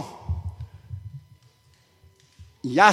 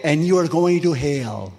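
ominous warning; 3 semitones lower, slower than usual. Retold or imitated. Preacher warns passenger.

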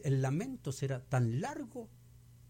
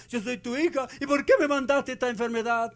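complaining believer; 8 semitones higher, 7 dB louder than usual. Retold or imitated. Preacher voicing complaining believer.

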